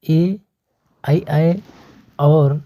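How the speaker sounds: एआई आए drawn out very slowly; slow emphasis. Shown as a demonstration. Teacher repeating the rule very slowly for students. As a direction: stretch out एआई आए for the class to catch. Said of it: "साथियों क्या है".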